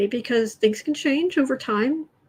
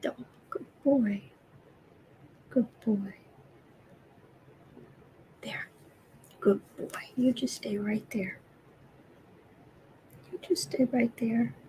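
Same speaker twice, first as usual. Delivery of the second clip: whispered praise; 8 dB quieter than usual. Real, not acted. Owner praises cat in lap, gentle whisper. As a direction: whisper softly so he stays.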